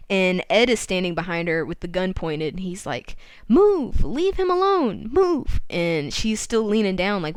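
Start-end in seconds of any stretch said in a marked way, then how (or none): 3.5-5.6 high, loud acted order; urgent command. Ed with a gun orders Susan back, urgent command. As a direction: order someone away at gunpoint. Gunman orders her away.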